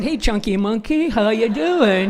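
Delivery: loud, slow, teasing greeting; sling it out carelessly; mock tactless greeting. Mocking her own clumsy remark to the woman, slow and teasing. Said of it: "it's like I said".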